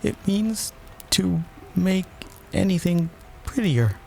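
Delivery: put-on high, hesitant girl's voice with pauses; small, uncertain, halting reply; doubtful. A narrator's reading. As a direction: feel out the answer word by word. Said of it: "said Alice doubtfully".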